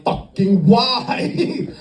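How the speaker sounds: high, hugely drawn-out "why"; exasperated disbelief. Retold or imitated. Comic reacting to the kink video, drawn-out exasperation. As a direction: stretch it out in mock bewilderment.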